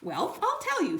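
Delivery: little boy playing Bert. A narrator's reading. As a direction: squeak it out like a muppet.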